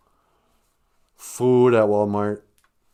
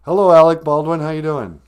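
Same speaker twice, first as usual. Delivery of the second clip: radio host greeting; 3 semitones higher, 8 dB louder than usual. Pretend.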